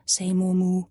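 plain reading voice

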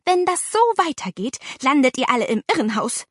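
high start, quickening to a scolding end; angry warning. A narrator's reading. Aunt scolding the children, angry. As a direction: threaten in exasperation.

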